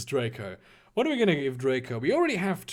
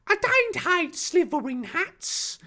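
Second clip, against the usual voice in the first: slow, very high cartoon voice; bold declaration; 13 semitones higher, slower than usual. Harry answering Draco about Slytherin hats, bold high put-on voice. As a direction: declare it in a silly high-pitched voice. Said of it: "Harry declared boldly".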